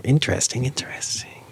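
hushed whisper. Real, not acted. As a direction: breathe it out softly. Spooky whisper reaction.

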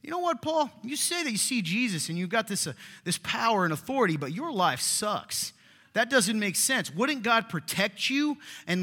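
high, loud mocking whine of the critics. Preacher mocking critics who scorn Paul's suffering, high and whiny. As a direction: sneer it like a scornful doubter. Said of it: "they were starting to say".

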